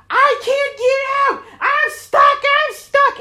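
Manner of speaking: high frantic screaming; hammed-up panicked shriek of a trapped woman. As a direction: shriek it like you're trapped in a car. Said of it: "She starts screaming".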